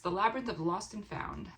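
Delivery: ordinary delivery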